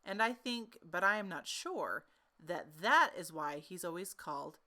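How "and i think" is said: plain reading voice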